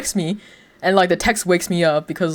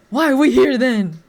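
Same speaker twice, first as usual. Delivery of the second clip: higher and louder, in disbelief; incredulous higher, louder complaint; exasperated; 8 semitones higher, 6 dB louder than usual. Retold or imitated. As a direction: throw up your hands at mom. Exasperated reply to mom retold.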